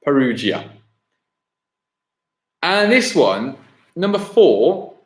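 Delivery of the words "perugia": Perugia as puh-ROO-ja; corrected pronunciation. Real, not acted. Quiz host confirming the answer, Perugia correctly said with ROO. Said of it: "my pronunciation".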